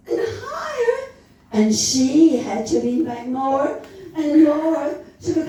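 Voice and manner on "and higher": climbing pitch. A narrator's reading. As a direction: voice soaring far up high. Rocket climbing, narrator's pitch climbs.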